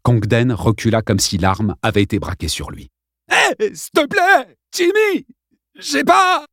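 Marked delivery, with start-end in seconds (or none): 3.3-6.4 much higher, slow, whining plea; bully pleads with the armed boy; ugly pleading. A narrator's reading. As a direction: beg in a nasty whine. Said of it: "supplia-t-il d'une voix encore plus immonde".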